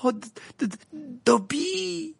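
winded, stuttering name; stammer, breath squeezed out. A narrator's reading. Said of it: "balbutia Harry".

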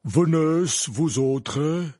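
commanding call to the pupils; imperious. Acted. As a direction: order them to come. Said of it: "d'un ton impérieux".

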